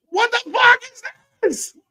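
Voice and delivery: high, loud indignant cry; outraged disbelief. Real, not acted. Reacting to a bad segment, shocked outburst. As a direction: blurt it out in shock.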